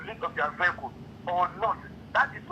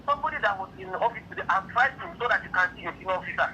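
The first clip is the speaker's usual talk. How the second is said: normal talking voice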